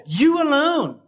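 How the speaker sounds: "you alone" with "you" lifted high; emphatic you alone. Shown as a demonstration. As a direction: stress "you" as the only one. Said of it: "verse two is emphatic".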